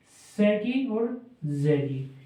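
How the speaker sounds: "से" और "की" को अलग-अलग धीमे-धीमे बोलें; दोनों ध्वनियाँ धीरे-धीरे साफ़ गिनाएँ; धीरे से ज़ोर देकर. Shown as a demonstration. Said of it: "इसमें दो तरह की साउंड आ रही है". (छात्रों को ध्वनियाँ समझाते हुए धीमा ज़ोर)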